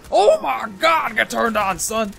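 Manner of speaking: high, loud, drawn-out outburst; playful shouting. Pretend. Player reacting to a flying grenade, playful loud yell. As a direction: yell it with stretched opening words.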